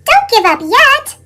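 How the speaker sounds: rally a tiny spider; high excited cheer. Acted. Pete cheering the spider up the spout, high and loud.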